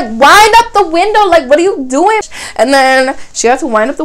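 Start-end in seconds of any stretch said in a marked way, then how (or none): 0.0-2.2 high, loud urgent plea; panicked order. Retold or imitated. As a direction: hurry her up in alarm. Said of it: "My friend's like".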